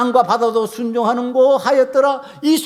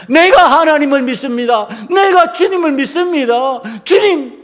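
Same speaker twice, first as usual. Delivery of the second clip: raised, ringing declaration of faith; fervent confession; 4 semitones higher, 4 dB louder than usual. Acted. Believer calling on the Lord, fervent cry. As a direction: proclaim it like a shouted prayer.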